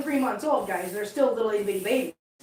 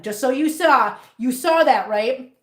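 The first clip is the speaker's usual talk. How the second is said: normal talking voice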